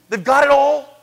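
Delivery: normal talking voice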